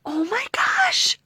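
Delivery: squealing, high, loud excitement; gushing. Real, not acted. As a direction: gush over an unexpected gift. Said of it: "I know, I'm gushing".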